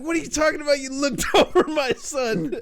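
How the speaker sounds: high, indignant dad imitation breaking into laughter; dad freaking out. Dad to prank caller, freaking out. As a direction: imitate an outraged father, laughing through it. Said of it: "he's, like, all freaking out".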